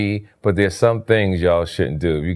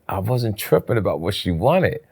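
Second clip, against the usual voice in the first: ordinary delivery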